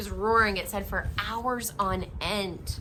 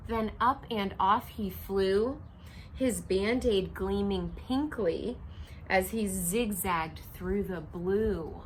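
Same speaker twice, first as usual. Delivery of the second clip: story narration; slower than usual. A narrator's reading. Reading picture book aloud.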